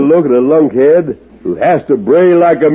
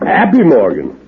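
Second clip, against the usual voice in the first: normal talking voice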